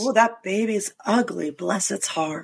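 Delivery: lightly voiced Southern lady, sugary sarcasm, dropping soft on "Bless its heart"; mock Southern sarcasm. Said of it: "learning Southern speak".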